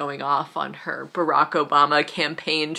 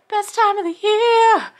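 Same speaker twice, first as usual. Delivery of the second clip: much higher and louder, sung-out Best time of the year; ecstatic repeat; 9 dB louder than usual. Real, not acted. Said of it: "this is the best time of the year".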